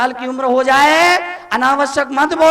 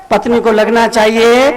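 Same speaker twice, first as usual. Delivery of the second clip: ordinary talk, nothing marked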